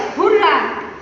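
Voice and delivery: mildly excited hurrah; sudden cheer. Shown as a demonstration. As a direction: give a short example cheer. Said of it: "giving the certain feeling like".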